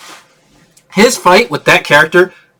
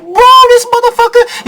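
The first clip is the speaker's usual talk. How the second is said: excited high-pitched rant. Real, not acted.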